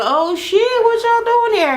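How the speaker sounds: high, startled mock greeting; caught off guard. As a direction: act surprised at being caught. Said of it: "he turned around like".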